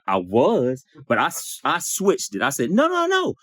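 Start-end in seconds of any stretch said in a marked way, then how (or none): 2.7-3.3 high, quick string of denials; mock-innocent protest, pitched way up; hasty denial. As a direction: backpedal in a raised pitch. Acts out his hasty denial.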